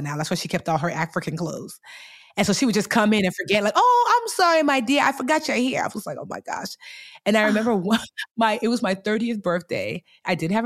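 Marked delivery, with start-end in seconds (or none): 3.8-5.8 high, loud singsong apology; apologetic mom. Retold or imitated. Mom to daughter after barging into her closet room, high apologetic singsong. 6.2-6.8 hushed, near-whispered aside; muttered dismay. Retold or imitated. Daughter to herself after mom barges in, hushed dismayed aside. Muttered reaction to mom's intrusion.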